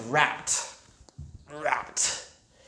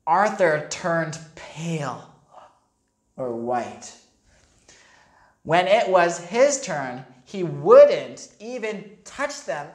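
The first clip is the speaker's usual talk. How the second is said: ordinary delivery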